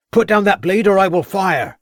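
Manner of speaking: high, loud constable's shout from a distance; shouted command. A narrator's reading. Constable warns the armed villain, a high loud shouted command. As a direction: bark the order to drop the blade. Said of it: "shouted the voice of Crabbe".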